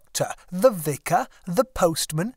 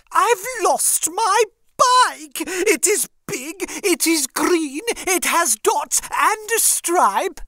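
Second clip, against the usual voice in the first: high, slow fretful lady's voice; worried lady; 13 semitones higher, 5 dB louder, slower than usual. Acted. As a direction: voice a flustered lady calling the police. Lady reports stolen bike to police.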